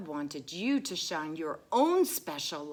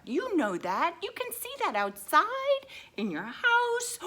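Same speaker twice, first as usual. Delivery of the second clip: excited; 7 dB louder than usual. Acted. Excited high reading.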